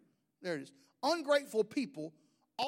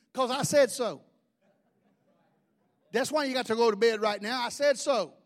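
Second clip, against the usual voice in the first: louder, final, no-discussion voice; firm dad; 2 semitones higher, 6 dB louder than usual. Retold or imitated. Father ending bedtime questions, louder and firm. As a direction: lay down the law.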